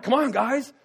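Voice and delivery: a cheerful urging shout; mock rallying call. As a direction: call them up out of the water. Calling friends to follow him.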